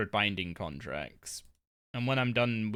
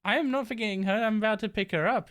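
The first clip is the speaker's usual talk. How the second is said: high, sing-song talk to a pet; high voice to the dog; 8 semitones higher, 4 dB louder than usual. Real, not acted. Streamer reassuring her dog Doris, high and sing-song. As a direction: coo at the dog that she's coming.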